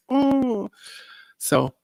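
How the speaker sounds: normal talking voice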